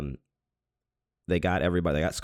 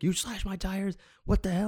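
mock screaming. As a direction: imitate yelling at a culprit, pitched way up. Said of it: "I'm, like, screaming at him".

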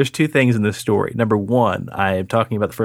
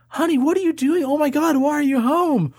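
high worried mother voice; alarmed mom. Retold or imitated. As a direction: play a startled mom rushing in. Mom finding crying child.

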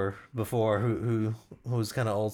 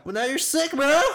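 much higher, louder bro voice; 8 dB louder than usual. Acted. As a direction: cheer him on like a gym bro. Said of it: "That's my bro voice".

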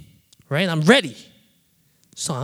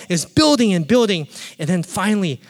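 ordinary talk

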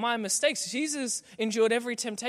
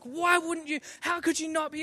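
higher-pitched exasperated questioning; indignant friend; 9 semitones higher than usual. Retold or imitated. Friend indignant that he never goes to the beach, higher-pitched. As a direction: pile on the disbelief. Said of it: "He was offended on Mooloolaba's behalf".

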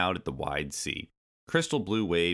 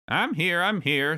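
high, slightly louder impatient call; cranky old monkey; 11 semitones higher, 4 dB louder than usual. Acted. Old monkey rejoining the others, high cranky call.

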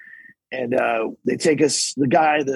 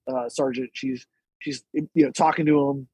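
ordinary talk

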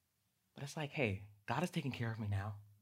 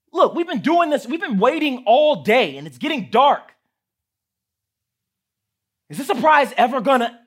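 much higher, much louder tirade; impatient rant; 12 semitones higher, 17 dB louder than usual. Shown as a demonstration. Gerald ranting at Piggy about the wait, loud tantrum. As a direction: wind up into a full tantrum.

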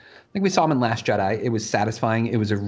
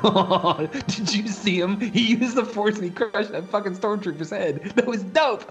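put-on much higher, gushing kid-like glee; giddy little kid; 9 semitones higher than usual. Fan watching Luke crush a stormtrooper, giddy and much higher. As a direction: bounce like a kid who just saw a hero. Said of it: "like a little kid, I was like".

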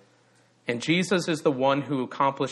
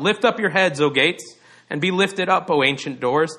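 raised, louder procession call; crying out; 4 semitones higher, 4 dB louder than usual. Acted. Procession cries out at the gates of Jerusalem, crying out. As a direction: cry it out to the gates. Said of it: "they would cry out".

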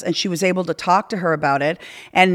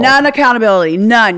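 louder, punching None; emphatic; 4 semitones higher, 6 dB louder than usual. Real, not acted. Guest admitting to the host she had no accountability, emphatic. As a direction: hammer the word None home.

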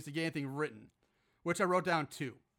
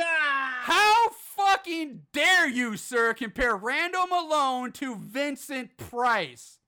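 hammed-up indignant cry, louder and higher; mock outrage; 5 semitones higher, 6 dB louder, much slower than usual. Podcast host scolds the reviewer in hammed-up outrage. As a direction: fake fury at a reviewer, drawn out slowly.

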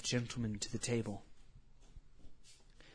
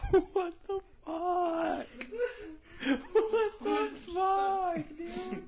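shocked yell; much slower than usual. Real, not acted. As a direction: shriek it in total shock. Player reacts to shocking roleplay.

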